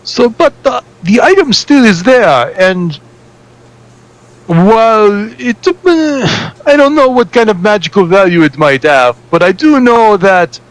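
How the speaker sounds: a mild foreign lilt telling about his thieving cousin; a hint of Yar's accent carried through the tale; accented NPC. NPC keeps telling his story.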